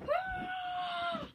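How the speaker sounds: high fighting yell; short sharp attack cry; battle shout. Acted.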